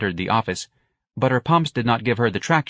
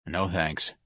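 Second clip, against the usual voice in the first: much softer, lower curt refusal; curt husband; 4 semitones lower, 7 dB quieter than usual. A narrator's reading. Husband declining to meet the clerk. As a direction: decline it dryly.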